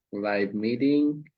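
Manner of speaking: usual speaking voice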